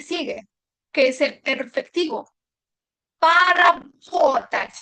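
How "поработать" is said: Поработать drawn out very slowly and louder, stress on the o; Поработать very slow and loud, stress on po-ra-BO-tat; slow loud demo. Shown as a demonstration. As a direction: call out Поработать syllable by syllable for the class. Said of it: "tilde на о".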